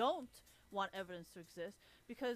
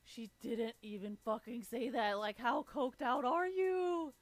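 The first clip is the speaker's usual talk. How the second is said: slow drawn-out disbelief building to a loud high finish; incredulous slow-motion scolding, getting louder; exasperated retort; 3 semitones higher, 6 dB louder, slower than usual. Acted. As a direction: stretch each word, rising to a yell. Acts out exasperated retort to housewife.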